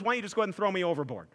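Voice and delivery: offhand rush. Acted. Jonah to the sailors, a quick offhand rush. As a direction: rattle it off carelessly.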